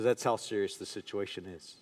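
very low, hushed retold doctor; grave. Doctor telling his patient how serious it is, grave and hushed. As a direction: drop almost to nothing, gravely. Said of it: "You know how bad it is".

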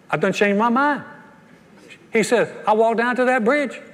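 a hint of Cajun, laughing at the punchline; Cajun accent. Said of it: "you don't do a good Cajun accent".

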